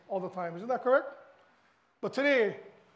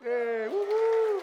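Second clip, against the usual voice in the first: high, loud, slow drawn-out cheer; 12 semitones higher, 6 dB louder, much slower than usual. Real, not acted. Husband celebrating his marriage in front of church, slow high cheer. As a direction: whoop it out for your wife.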